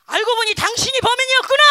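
playful pretend outburst at God; mock accusation. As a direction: fling the blame in mock fury. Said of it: "이렇게 했을까?".

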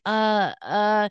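drawn-out stalling uhs; stuck, searching hesitation; fumbling for words. Retold or imitated. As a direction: imitate someone caught off guard. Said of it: "he was searching for something to say".

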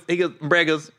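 ordinary delivery

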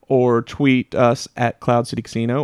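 ordinary talk

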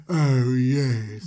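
creepy ruler voice. Retold or imitated. Joseph questioning the brothers, low and slow creepy voice. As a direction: loom over them, slow and low like a creep. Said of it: "He's like".

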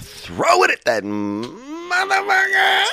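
very high, drawn-out shriek; comic yell. Pretend. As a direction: stretch the insult into a wild scream. Rock-throwing kid yelling at someone.

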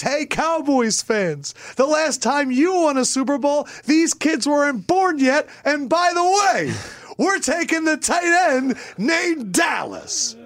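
high, slow gloating announcement; gloating David Akers impression. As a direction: rub it in slowly, high and proud. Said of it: "David Akers goes out there and says".

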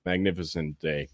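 usual speaking voice

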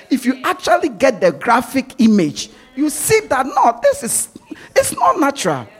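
escalating preacher pitch ending in laughter; rising preaching. Real, not acted. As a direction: build it up until you laugh at the absurdity.

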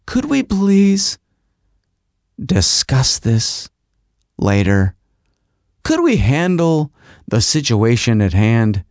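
very slow, word by word, dropping low; measured request with long pauses; strained patience. Retold or imitated. Husband asks wife to postpone the argument, strained patience. Pleading with wife mid-quarrel.

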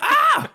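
high loud scream of falling. Retold or imitated. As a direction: scream as you tumble. Acting out Scarlet's fall.

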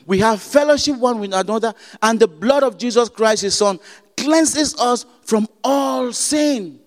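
fervent sermon delivery; preaching. Acted. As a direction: proclaim the verse to the congregation. Preaching scripture reading.

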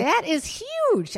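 slow, high, stretched-out that is huge; drawn-out amazement. Real, not acted. Mom gawking at a photo, slow high amazed drawl. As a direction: stretch it out in shocked awe. Reacting to huge penis pic.

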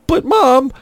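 high-pitched mock pleading; pleading protest. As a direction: protest like a kid to a parent. Boy pleading with sleepy mom.

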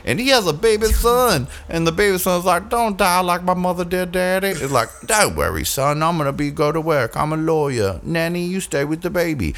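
lightly voiced movie scene. Retold movie, voices son.

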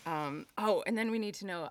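ordinary talk

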